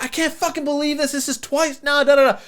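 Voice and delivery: imitating the bride yelling at her groom, pitched far up; mock angry bride yell. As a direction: squeal it like a bride losing her temper. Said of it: "She's yelling at the top of her lungs".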